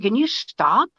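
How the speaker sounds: exasperated plea. Retold or imitated. At dinner, telling George to stop tearing into Howard, exasperated. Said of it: "he said, I said".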